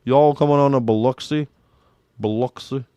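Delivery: mock Mississippi accent, "Biloxi" as buh-LUK-suh; slack-jawed Mississippi accent. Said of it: "they got that slack-jawed accent, man".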